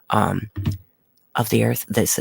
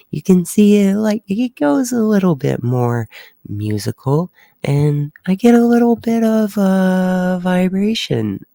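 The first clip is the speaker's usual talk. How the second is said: much higher, musical lilting voice; sing-songy voice; 9 semitones higher, 5 dB louder than usual. Shown as a demonstration. Showing viewers a musical voice on the spectrogram, high sing-song. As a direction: let the pitch float up high and sing-songy to show the vibration. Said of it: "there's some sing-songy".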